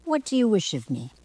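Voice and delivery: lightly voiced haughty bird, quick; a hint of a proud peacock. Peacock asking the girl her wish, haughty. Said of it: "said the peacock".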